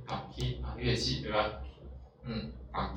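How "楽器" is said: 楽器 said "gakki", with a stop held before "ki"; geminate consonant. Shown as a demonstration. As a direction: hold the double k in 楽器, "gak-ki". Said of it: "这个促音". (楽器读作gakki，中间有促音停顿)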